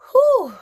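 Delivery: loud high whoop of exhaustion; loud, high exclaimed exhale; emphatic whew. Real, not acted. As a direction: let out a big high "whew". Reacts to memory of the period.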